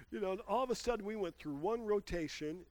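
ordinary talk

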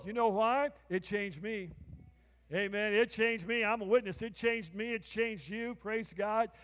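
loud sermon calling for amens; full-force preaching. Acted. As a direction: build it up, ring it out to the crowd. Preaching to congregation, calls for amen.

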